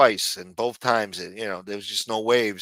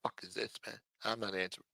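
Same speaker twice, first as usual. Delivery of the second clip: quiet grumble; 2 semitones lower, 17 dB quieter, faster than usual. Real, not acted. Streamer to himself about an unanswered call, quiet grumble. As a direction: grumble it under your breath.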